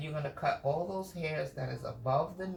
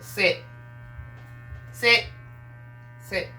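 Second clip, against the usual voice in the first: loud, higher sit command; sharp command; 5 semitones higher, 5 dB louder, slower than usual. Real, not acted. Groomer ordering her dog to sit, loud.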